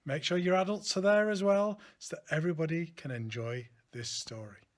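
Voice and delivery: ordinary delivery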